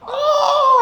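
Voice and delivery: high, loud, almost sung oh no; mock amazement, singing out the oh; amazed disbelief. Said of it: "And he was like".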